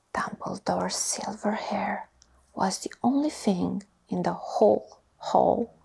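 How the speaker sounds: ordinary delivery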